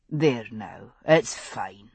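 put-on deep, soft soothing to baby; low murmur. A narrator's reading. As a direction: hush a stirring baby, low. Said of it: "Jamie murmured".

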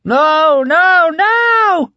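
very high, loud terrified screaming; frenzied scream. A narrator's reading. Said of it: "Heck screamed in frenzied counterpoint".

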